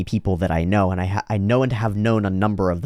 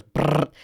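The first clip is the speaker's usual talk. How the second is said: high lip-trill brr; playful child lip buzz; kid's silly brr noise; 6 semitones higher than usual. Retold or imitated. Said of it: "the kid goes".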